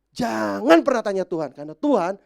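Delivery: high, loud fiery preaching; preaching at full force. Acted. Pastor exhorting the congregation, fiery loud preaching. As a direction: preach it out with rising fire.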